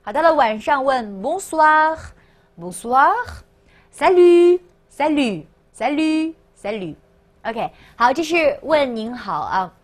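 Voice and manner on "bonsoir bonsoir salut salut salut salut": long-held vowels, evenly spaced words; slow read-along. Shown as a demonstration. Teacher reviews evening greetings with the class, slow model reading. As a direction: repeat each word slowly and clearly for learners. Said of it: "大家再跟我一起读一下".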